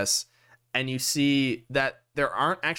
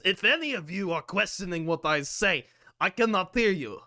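higher-pitched Russian captain voice; Russian accent; 4 semitones higher than usual. Acted. Captain answers questioning party members, in a put-on Russian accent. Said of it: "I also have Russian accent now".

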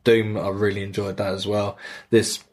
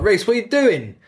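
mock exasperated call; 11 semitones higher, 3 dB louder than usual. Captain scolding crewmate in game.

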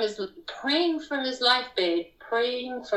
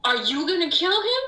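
higher, pleading repeated question; alarmed question; 7 semitones higher, faster than usual. Retold or imitated. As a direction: ask it twice in rising alarm. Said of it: "So then I'm just like".

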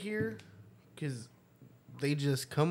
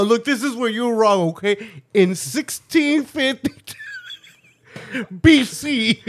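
mock preacher; 6 semitones higher, 8 dB louder than usual. Mock preacher citing scripture.

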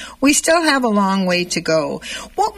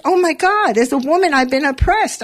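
retold realization; 7 semitones higher than usual.

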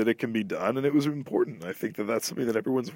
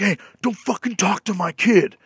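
higher, louder mock tough-guy dad; aggressive dad; 9 semitones higher, 5 dB louder than usual. Angry father in the theater confronting the comedian, in a higher, louder mocking voice. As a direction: snap like a dad protecting his kid. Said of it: "He's like".

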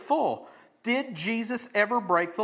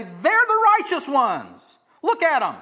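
loud, high crowd exclamation; acted awe; 6 semitones higher, 6 dB louder than usual. Crowd hailing the scribes and Pharisees, in loud awe. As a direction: point them out loudly, drawing the words out.

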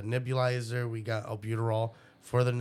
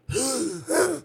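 a sharp, high barking gasp like a seal; croup cough imitation; 5 dB louder than usual. Dad imitates his son's croup cough, high seal-like barking gasp. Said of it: "so kinda like that".

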